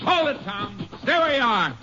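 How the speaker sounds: high, urgent call across a distance; sharp commanding hail, pitched well up. Acted. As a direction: stop the running man with your voice. Ranger yells at fleeing suspect.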